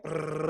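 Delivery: rolled brrr for spiralling thoughts; imitated looping whir. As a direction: trill it like a loop spinning. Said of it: "it's like this loop".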